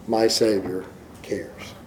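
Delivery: slow soft whisper. Acted. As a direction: breathe the words out, barely voiced. Quiet close of hymn.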